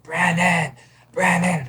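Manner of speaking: high, calling a name twice. Retold or imitated. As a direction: call her name through the door. Patient calling her name at the door.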